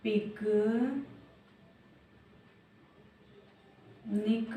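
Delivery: very slow, stretched word; drawn-out word. Shown as a demonstration. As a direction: stretch it out syllable by syllable. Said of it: "दूसरे का answer".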